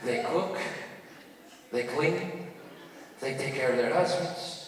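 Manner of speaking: put-on a hushed old man confiding; grandpa voice. Grandpa whispers his secret to his grandson, low and soft. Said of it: "he goes".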